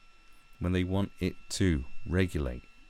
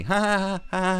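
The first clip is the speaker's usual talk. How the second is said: mock ha-ha laugh; 13 semitones higher, 4 dB louder than usual. Mock laugh at kids' fun.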